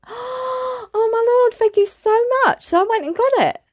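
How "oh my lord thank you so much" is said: high, drawn-out gushing gratitude; gasp, then gush in a high voice; acted-out delighted amazement; overjoyed thanks. Acting out delighted thanks after gasp.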